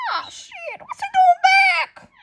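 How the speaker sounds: shocked shout. Acted. Acting shocked characters.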